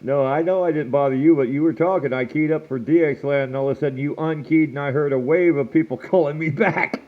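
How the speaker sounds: ordinary delivery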